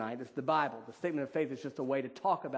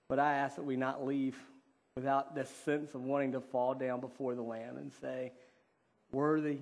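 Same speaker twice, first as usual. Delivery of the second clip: prayer; 2 semitones lower than usual. Real, not acted. Pastor prays that they leave in love with God, subdued.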